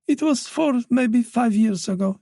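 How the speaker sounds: put-on an elderly mother, voice lifted by worry; anxious old mother voice. Wife taking over.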